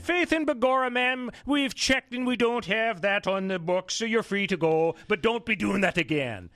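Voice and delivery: high, hammed-up Irish cop; mock Irish brogue, like a leprechaun; Irish accent. Cop voiced after "Irish cops.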